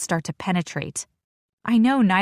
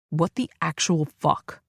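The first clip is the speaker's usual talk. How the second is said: growling; slower than usual. Acted. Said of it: "Sai growls".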